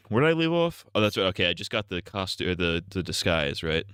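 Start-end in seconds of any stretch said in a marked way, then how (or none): 0.1-0.9 put-on character voice. Mimicking game character after throat clear.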